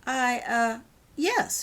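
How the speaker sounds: stammer, taken aback; surprised. Acted. Old lady surprised by a girl's offer, flustered. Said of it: "surprised at the question".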